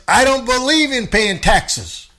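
mocking tax dodger. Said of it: "some Christians turn around and say".

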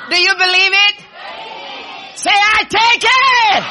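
high, loud call and response; rousing shout. Real, not acted. Preacher rallying the congregation to claim it, shouting. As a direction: rouse them into shouting it back.

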